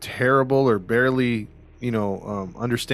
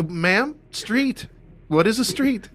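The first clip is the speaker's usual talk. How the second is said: mock dispatcher; 9 semitones higher, slower than usual. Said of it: "mock dispatcher".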